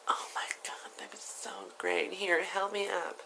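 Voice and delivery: high, soft, whispery put-on voice; voiced older woman. Woman asking strangers to help her up, high put-on voice.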